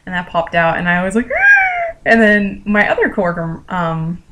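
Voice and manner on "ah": short, high re-enacted shriek; startled scream. Recalling the earwig popping out, startled shriek. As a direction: yelp as if a bug popped out. Acts out fright as bug emerges.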